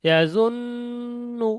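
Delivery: ইয়াজুন্নু with a long hummed n, held high; slow ইয়াজুন্নু, the doubled n drawn out nasally; nasal ghunna. Shown as a demonstration. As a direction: hold the ন in ইয়াজুন্নু in the nose, then release to nu. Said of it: "মেলানোর পরে গুণনা করতে হবে".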